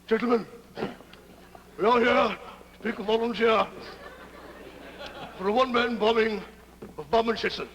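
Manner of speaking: stiff, projected military address; colonel's speech. Acted. Colonel briefing his men on a bombing mission, declaiming. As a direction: address the squadron in measured, halting phrases. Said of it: "Colonel Brack is making a speech to his men".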